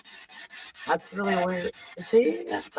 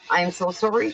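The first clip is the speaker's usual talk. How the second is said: ordinary delivery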